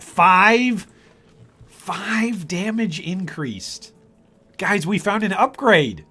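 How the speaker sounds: high-pitched, thrilled announcement; excited. Real, not acted. Streamer celebrating a found upgrade with viewers, excited. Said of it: "I'm excited".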